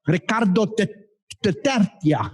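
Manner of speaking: mock Mexican. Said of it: "What's that in, in Mexican?".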